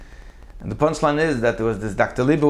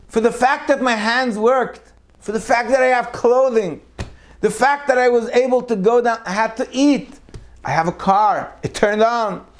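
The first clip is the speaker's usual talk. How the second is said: fervent listing; 10 semitones higher than usual. Acted. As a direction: pile up each blessing with rising fervor. Listing blessings with rising fervor.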